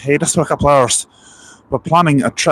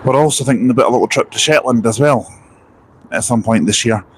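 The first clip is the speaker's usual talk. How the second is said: ordinary talk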